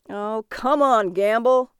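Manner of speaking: mild yell of protest. A narrator's reading. Thompson heckles at the auction, yelling out. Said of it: "Thompson yelled out".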